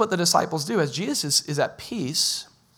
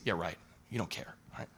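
much softer, lower, dismissive; sarcastic mutter; 10 semitones lower, 14 dB quieter than usual. Retold or imitated. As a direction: toss it off with a sneer. Mocking reply from the accuser.

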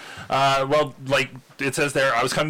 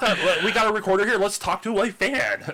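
eager host voice; 10 semitones higher than usual. Pretend. Imagined seasoned podcaster.

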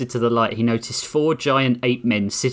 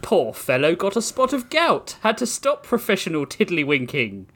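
put-on higher, posh British accent; posh British gentleman; 6 semitones higher than usual. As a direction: lament it with stiff upper lip. British ape voice.

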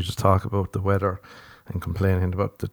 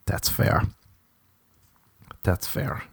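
quiet concession; 2 semitones lower, 10 dB quieter, slower than usual. Acted. Accepting that the date walks out, quietly conceding. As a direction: concede it softly, almost to yourself. Acting his reply to being left.

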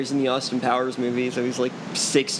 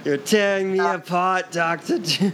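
Tommy Wiseau impression; 6 semitones higher, slower than usual. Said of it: "Tommy Wiseau parody quote".

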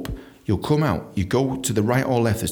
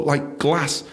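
ordinary talk, nothing marked

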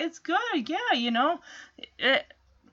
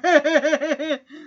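teasing laugh. Retold or imitated. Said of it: "Chip is just teasing him".

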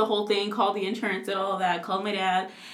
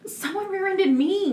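high-pitched defensive objection; indignant protest; 9 semitones higher than usual. Real, not acted. Correcting a friend's accusation about rear-ending, high and indignant. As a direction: jump in protesting, voice shooting up.

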